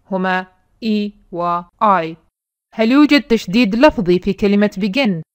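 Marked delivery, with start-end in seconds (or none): 0.1-2.2 slow, clear E and I, each vowel said on its own; vowels named slowly. Shown as a demonstration. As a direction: spell out E and I one by one, slowly. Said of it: "لأن لدينا صوتين متحركين". (نطق E و I ببطء ووضوح)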